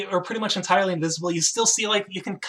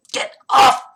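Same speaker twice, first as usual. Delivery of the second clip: high, loud squeal; squealing kobold; 9 semitones higher, 8 dB louder than usual. Acted. Pinned kobold squealing at the player. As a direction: squeal it like a pinned little creature. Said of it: "he's just kind of squealing".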